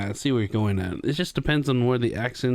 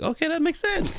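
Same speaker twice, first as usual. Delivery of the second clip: retold realization. Retelling own reaction to hearing it.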